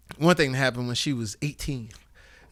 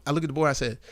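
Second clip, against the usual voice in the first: ordinary talk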